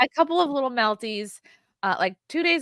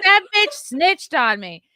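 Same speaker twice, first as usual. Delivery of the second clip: indignant; 7 semitones higher, 5 dB louder, slower than usual. Retold or imitated. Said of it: "He's like".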